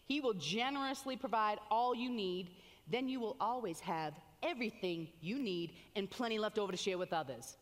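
normal talking voice

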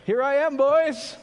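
put-on loud, high, hearty call to the boys; Jesus calling out. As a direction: shout it out like a triumphant announcement. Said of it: "Then Jesus shows up and goes".

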